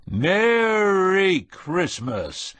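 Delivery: very slow, high booming greeting; drawn-out bellow at the microphone. A narrator's reading. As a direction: boom it over the crowd. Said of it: "he bellowed".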